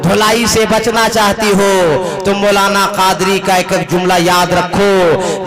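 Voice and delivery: slow, loud sermon exhortation; loud slow fiery sermon; full-force preaching. Acted. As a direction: drive the advice home to the women.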